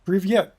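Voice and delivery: Привет said loud, rolled r and stressed vyet; громкое Привет; Russian hello. Shown as a demonstration. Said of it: "That is hello".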